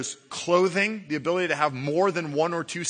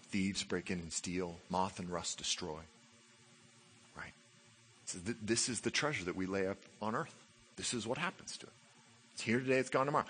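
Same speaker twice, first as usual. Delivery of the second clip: ordinary delivery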